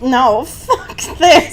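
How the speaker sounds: slow, laughing refusal; mock Bella fed up. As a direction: drag it out like you're done with it. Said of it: "she sees Jake there and is like".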